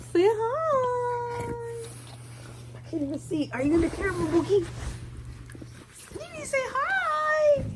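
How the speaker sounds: high, loud sing-song call to a pet. Real, not acted. Owner calling her dog Nene to the camera in a high pet voice. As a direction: coax the dog over sweetly.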